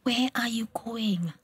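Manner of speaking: whispered worried question; slow whisper. Acted. Secret night meeting, whispering.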